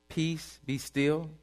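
soft peace. Acted. Quoted Jesus softly.

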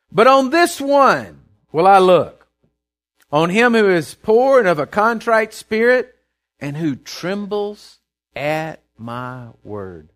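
loud, deliberate reading that slows and settles; forceful Bible reading easing into solemn slowness; scripture proclamation. Acted. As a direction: proclaim it, then slow right down word by word. Reads God's words aloud.

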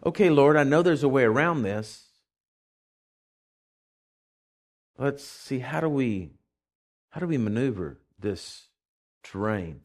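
low, softening voice of trust; calm faith. Acted. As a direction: quietly think it through with God. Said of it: "That's what faith would say".

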